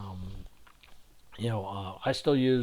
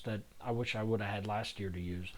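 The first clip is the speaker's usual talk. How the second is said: quick wistful whisper; whispered aside; 4 semitones lower, 8 dB quieter than usual. Real, not acted. Wistful aside under breath.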